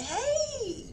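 soft, very high drawn-out hey; imitating a girlfriends' singsong hello; playful greeting. Acted sing-song greeting to friend.

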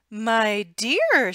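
put-on loud alarmed old woman; crying out. Old woman crying out to her husband, loud. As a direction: call out to her husband in dismay. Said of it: "she cried".

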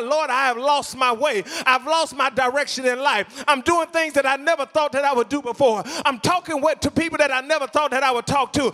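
impassioned pleading, high-pitched and insistent; fervent preaching. Acted. Preacher voicing a struggling believer's plea to God, fervent preaching. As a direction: pile up each plea in a driving preacher's cadence.

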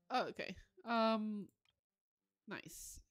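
ordinary talk, nothing marked

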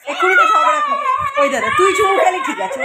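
high, loud scolding shout. Real, not acted. Scolding squabbling kids.

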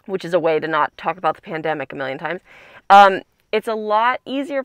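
muffled by clear plastic; covered mouth speaking; through a face mask. Real, not acted. Seen 3: Transparent mask worn over mouth and nose throughout all frames.

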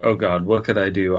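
ordinary delivery

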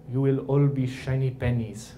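poor attempt at Mother Teresa's Indian-Albanian accent; slightly off Indian accent; bad Indian accent. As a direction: imitate an accented nun, Indian-sounding and rough. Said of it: "Sorry for the Indian accent".

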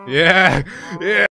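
loud whooping cheer; cheering shout. Real, not acted. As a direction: cheer them on loudly. Cheering on the attack.

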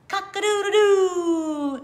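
loud high crowing rooster call. Acted. Reading to a child, the rooster crows loudly. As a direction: crow it out like a proud rooster.

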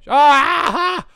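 startled shriek. Real, not acted. Dog suddenly appears in room.

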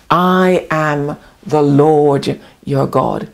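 normal talking voice

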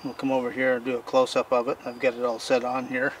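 ordinary delivery

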